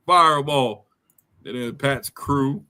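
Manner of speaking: ordinary delivery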